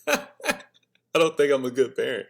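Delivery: slow, laughing confession; laughing admission. Real, not acted. Confessing doubts about parenting, slow with a laugh. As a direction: admit it through a laugh.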